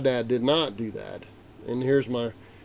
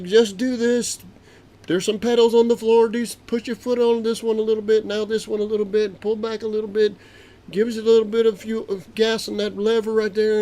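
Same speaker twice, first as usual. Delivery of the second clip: tower instructions; 9 semitones higher, 5 dB louder than usual. Retold or imitated. Tower guides landing.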